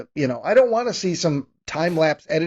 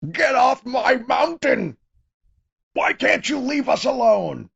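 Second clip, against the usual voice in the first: shouting killer; 7 semitones higher, slower than usual. Retold or imitated. Killer yells at Cole.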